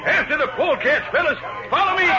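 high, loud battle cry; rallying shout. Acted. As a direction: call the men to charge. Leading the charge.

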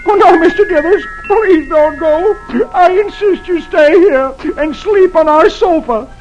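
high, slow tearful plea; mock-sob the plea to make him stay; sobbing. A narrator's reading. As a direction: Dagwood sobbing, begging his boss to stay. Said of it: "and he sobs".

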